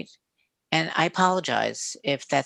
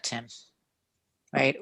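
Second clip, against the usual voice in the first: ordinary talk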